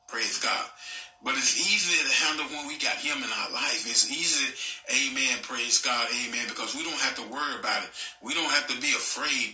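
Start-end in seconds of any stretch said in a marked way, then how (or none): none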